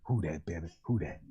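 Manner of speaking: put-on a low, soft old reverend soothing his flock; Reverend Saint family. Low reverend voice comforting the family about a story. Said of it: "that's Reverend Saint family hollering".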